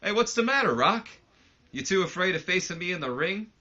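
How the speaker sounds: high, loud mocking call across the arena; taunting shout. Shane cuts off the Rock with a high, loud taunting shout. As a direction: bellow the taunt at him. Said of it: "and he goes".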